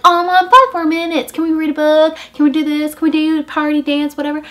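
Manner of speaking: put-on high, loud pleading kid voice; whiny kids begging. Girls begging mom.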